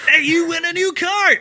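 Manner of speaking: high, bright prize reveal; game-show announcer. Pretend. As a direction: announce a car giveaway. Echoes game-show announcer bit.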